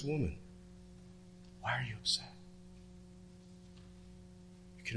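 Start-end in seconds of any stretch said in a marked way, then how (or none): 0.0-2.3 a hint of Jesus, softer and gentle; quiet Jesus. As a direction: ask her softly, as Jesus. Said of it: "And he says".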